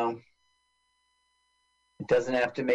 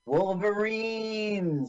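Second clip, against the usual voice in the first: overacted death scene; 9 semitones higher, much slower than usual. Echoing the movie's dying cry.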